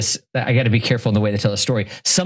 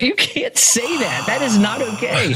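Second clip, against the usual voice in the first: high, slow, aghast protest; shocked; 11 semitones higher than usual. Retold or imitated. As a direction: gasp out a scandalized objection. Classmates' shocked reaction, retold.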